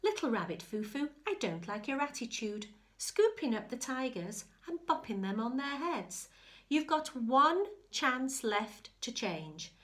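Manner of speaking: a bossy good fairy scolding; stern fairy. Acted. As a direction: scold the rabbit like a fussy fairy. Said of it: "and said".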